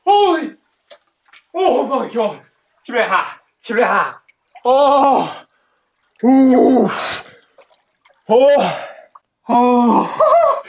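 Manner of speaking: high loud shrieks from the cold; cold shock yelps. Real, not acted. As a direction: scream at the icy water. Jumped into freezing pool.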